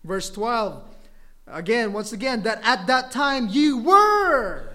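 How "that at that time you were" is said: loud build to a long "were"; stress on WERE. Shown as a demonstration. Preacher driving home past tense, stress on "were". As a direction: build and hold "were" at full volume.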